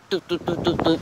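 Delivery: high, quick doot-doot typing noises; playful beeping imitation; imitated typing beeps. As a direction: mimic a computer beeping as keys are hammered. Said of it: "That's how, that's how it sounded".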